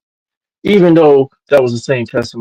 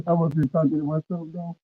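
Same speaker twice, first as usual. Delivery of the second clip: muffled; 7 semitones higher, 9 dB quieter than usual. Real, not acted. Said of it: "you sound muffled".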